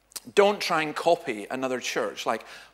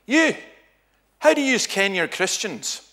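loud, high challenge in broad Scots; Scots accent; 9 semitones higher, 7 dB louder, slower than usual. Retold or imitated. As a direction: fire the question in a Scots accent like a heckler. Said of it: "so I'll do it in Scots first".

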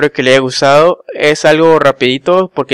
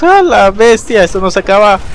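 excited; 6 semitones higher, 4 dB louder than usual. Real, not acted. Big enemy arrives.

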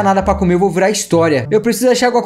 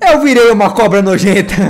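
laughing. Real, not acted. Ri ao virar cobra.

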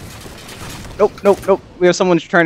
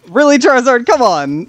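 exasperated; 8 semitones higher, 4 dB louder than usual. Real, not acted. Teammate grenade kill, mock exasperation.

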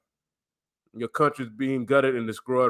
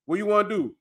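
usual speaking voice

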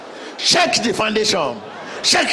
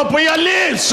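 ordinary talk, nothing marked

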